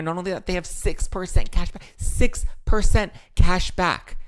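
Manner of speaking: excited. Acted. Excited ad hype.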